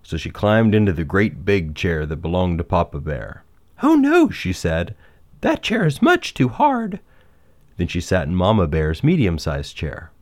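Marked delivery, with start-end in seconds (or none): 3.8-4.3 high, louder cry of dismay; high-pitched "oh no" character voice; Goldilocks, dismayed. Acted. 5.4-7.0 put-on high-pitched storybook girl, displeased; high, fussy character voice; Goldilocks, complaining.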